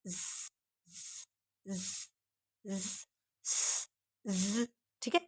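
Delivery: soft slow ज buzz; z sound. Shown as a demonstration. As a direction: buzz ज softly, drawn out. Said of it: "जेड का क्या होता है?". (धीरे से गूंजता ज)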